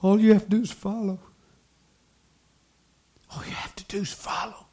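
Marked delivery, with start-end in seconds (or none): 3.3-4.7 hushed, voiceless whisper. Real, not acted. Preacher repeating the key point to the congregation, whispering. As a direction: drop to a whisper to let it sink in.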